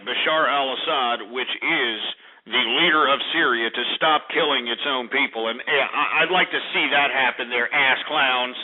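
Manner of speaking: usual speaking voice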